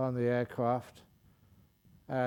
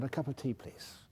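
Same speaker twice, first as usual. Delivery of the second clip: polite; 8 dB quieter than usual. Retold or imitated. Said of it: "the fellow said".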